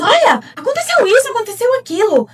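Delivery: high, rushed burst of agitation; frantic imitation of a nervous partner; nervous, desperate. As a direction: blurt it out fast, acting someone worked up. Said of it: "ele chega nervoso".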